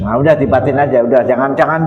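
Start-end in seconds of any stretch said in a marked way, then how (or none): none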